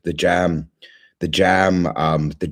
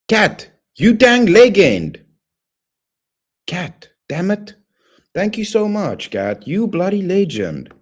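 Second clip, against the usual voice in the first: very high, slow, gushing thanks; thrilled high-pitched thank-you; squealed thanks; 12 semitones higher, slower than usual. Real, not acted. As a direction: squeal with gratitude at a donation.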